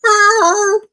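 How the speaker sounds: high, loud cat meow. Retold or imitated. Cat at the door calling her owner, loud high meow. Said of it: "my cat walks into the room and goes".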